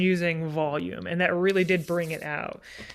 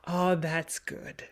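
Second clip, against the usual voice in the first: slow, soft seductive murmur falling to a whisper; a seduction voiced low and hushed; 3 semitones lower, 8 dB quieter, slower than usual. Shown as a demonstration. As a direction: coax them in, slowly and softly. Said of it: "it's almost like a seduction".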